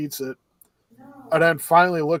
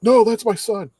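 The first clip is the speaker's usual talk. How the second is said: higher, louder stop-it cry; mock protest; 7 semitones higher, 5 dB louder than usual. Restaurant owner stopping the gorilla's punch, higher and louder. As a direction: rush in to stop him.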